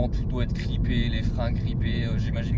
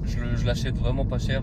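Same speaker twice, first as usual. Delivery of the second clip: ordinary talk, nothing marked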